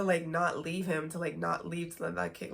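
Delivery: ordinary talk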